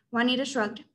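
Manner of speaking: usual speaking voice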